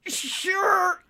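high, strained single word; squeezed reply while holding back; barely managing. Acted. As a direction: force it out through the pressure. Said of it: "Nimbus managed to say".

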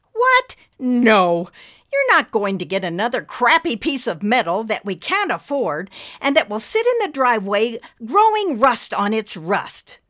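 high, loud outburst of objection; fired-up anger. A narrator's reading. Wife objects to another junk car, fired up. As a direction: flare up and forbid it. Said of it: "more starter fluid in her voice than usual".

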